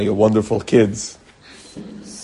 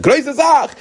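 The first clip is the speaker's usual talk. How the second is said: mock exclamation; 12 semitones higher, 4 dB louder than usual. Rabbi's exclamation retold.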